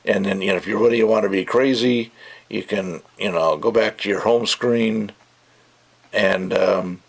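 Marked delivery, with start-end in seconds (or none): none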